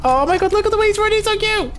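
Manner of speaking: high excited coo, fast at the end; high giddy gushing. Real, not acted. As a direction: squeal over how cute he is. Gushing at running figure.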